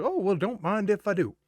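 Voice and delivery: high-pitched, hammed-up delight; playful acceptance. Accepting Coin's offer of his stash, playful high delight. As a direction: put on a high, tickled voice.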